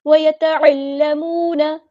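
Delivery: slow melodic chant, drawn out; Quran recitation. Shown as a demonstration. Teacher models the verse for students, chanting. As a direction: intone the verse like a qari.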